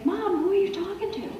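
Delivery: put-on high little-kid voice asking mom; high-pitched child's question; small child. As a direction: imitate a puzzled young child, pitched well up. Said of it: "when my kids were babies, they'd say".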